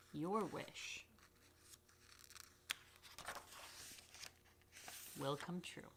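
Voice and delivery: storybook finale; reading to children. Acted. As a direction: close the story gently. Reading picture book to children.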